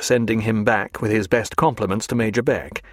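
ordinary delivery, nothing marked

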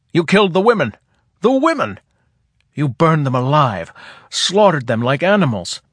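rising to a raw yell; furious shouting. Acted. Gossa raging at Selver.

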